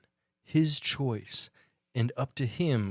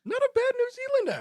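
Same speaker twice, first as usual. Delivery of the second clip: much higher, teasing voice; high mock praise; 5 dB louder than usual. Real, not acted. Teasing the friend about his accent, high and playful. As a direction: tease him in a high voice. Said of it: "That was a pretty bad New Zealand".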